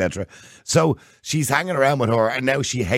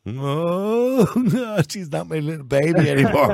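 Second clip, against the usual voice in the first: mock heartbroken dad; 8 semitones higher, slower than usual. Dad's inner wail over grown daughter.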